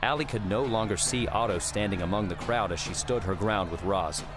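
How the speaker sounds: ordinary delivery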